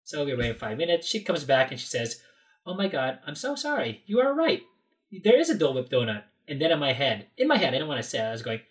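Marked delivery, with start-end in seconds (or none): 2.6-6.2 high-pitched imitation of an apology; mock apologetic. Employee returns to admit the donut exists, apologetic. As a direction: imitate a gushing admission of being wrong.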